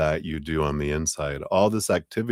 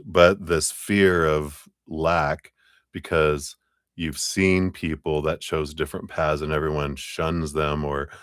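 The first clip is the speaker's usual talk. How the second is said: usual speaking voice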